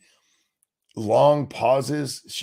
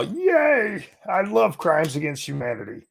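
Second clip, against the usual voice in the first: squealed mocking yay, then a mock-happy crowd voice; high mock delight; sarcastic cheer; 9 semitones higher than usual. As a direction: parody the cheering audience. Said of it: "That, but no".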